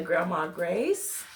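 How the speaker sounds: ordinary delivery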